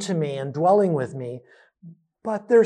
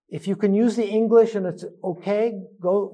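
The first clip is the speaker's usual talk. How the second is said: ordinary talk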